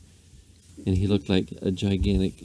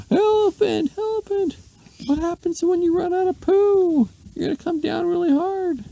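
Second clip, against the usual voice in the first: very high, loud squeaky lady screaming up at the sky; 4 dB louder than usual. A narrator's reading. Lady screaming at the elephant flying into space, very high shriek.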